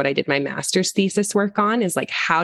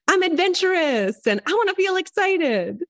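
much higher, eager thrill-seeker voice; eager mock excitement; 12 semitones higher than usual. Spicy parent explaining what self-care means, eager. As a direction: sell the adventure gleefully. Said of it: "because you're like".

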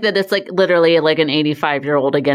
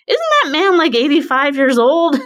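incredulous husband; 9 semitones higher, 3 dB louder than usual. Retold or imitated. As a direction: play the stunned husband asking, half-joking. Husband's astonished question retold.

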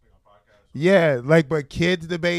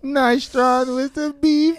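put-on slow, much higher Jamaican accent, Nostrand as NAIstrahn; mock Jamaican accent; 9 semitones higher, slower than usual. Host jokes about Jamaican beef patties in a slow, high Jamaican accent. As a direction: ham up a Jamaican patty order. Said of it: "very Jamaican".

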